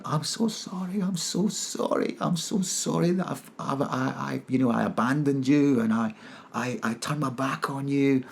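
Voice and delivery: high, soft, pleading apology; small, high voice full of regret; remorseful. Retold or imitated. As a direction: re-enact a tearful sorry, hushed and high. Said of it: "I felt total remorse".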